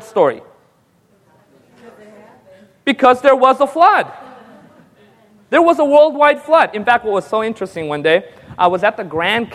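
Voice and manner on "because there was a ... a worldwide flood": louder, higher proclaiming; emphatic answer. Real, not acted. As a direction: hammer the answer home. Preacher answers his own question.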